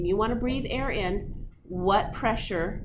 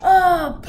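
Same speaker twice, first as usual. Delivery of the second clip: loud high ah of a molecule crashing; bursting ah, louder and higher; playful shout; 8 semitones higher, 12 dB louder than usual. Retold or imitated. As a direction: yell like slamming into walls. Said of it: "just going".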